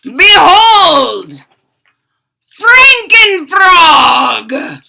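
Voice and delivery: loud, high, slow grand announcement; booming mad scientist reveal; mad scientist unveiling. Acted. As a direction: present the monster proudly. Doctor unveils his creation.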